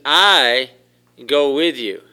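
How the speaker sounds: loud, drawn-out stress on I; emphatic I. Acted. Preacher quoting God's promise to go along, emphatic I. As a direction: punch "I" hard, then "go with you". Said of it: "the I there is emphatic".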